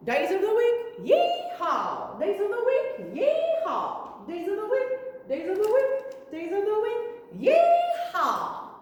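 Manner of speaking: high, loud cowboy singsong with a yee-haw. Shown as a demonstration. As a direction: sing it like a rodeo cowboy. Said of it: "Let's do cowboy voices".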